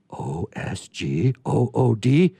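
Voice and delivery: ordinary talk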